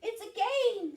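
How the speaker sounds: high, loud mouse squeak; tiny high-pitched mouse voice. Acted. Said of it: "squeaked Mouse".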